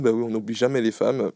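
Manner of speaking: ordinary talk, nothing marked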